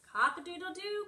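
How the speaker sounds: high, loud storybook rooster crow. A narrator's reading. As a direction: crow it out like a rooster for little kids. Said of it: "crowed the rooster".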